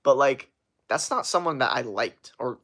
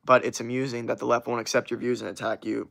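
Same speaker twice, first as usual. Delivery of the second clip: usual speaking voice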